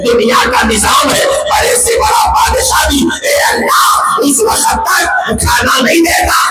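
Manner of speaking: high, strained crying-out plea, building in pitch; full-force impassioned preaching. Real, not acted. Preacher pleading with God before the crowd, full-force impassioned preaching. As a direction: cry out to God in shouted, rhythmic phrases.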